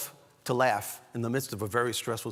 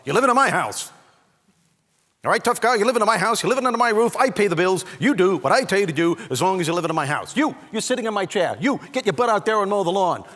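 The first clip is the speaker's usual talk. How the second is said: loud, higher-pitched bossy dad rant; father laying down law; 7 semitones higher, 8 dB louder than usual. Retold or imitated. Father to his teenage son living at home, loud bossy dad impression. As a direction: lay down the law like an exasperated father. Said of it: "especially from your father".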